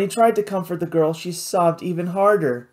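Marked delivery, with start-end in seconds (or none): none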